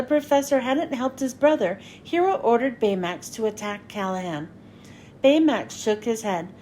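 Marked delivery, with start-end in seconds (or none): none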